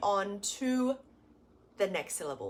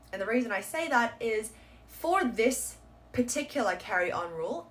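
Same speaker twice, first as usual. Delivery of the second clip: ordinary talk, nothing marked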